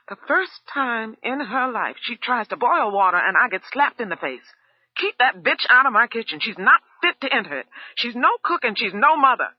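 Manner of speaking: loud, high furious rant; fuming. A narrator's reading. Cook fuming about the slap, loud and furious. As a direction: spit it out in rage.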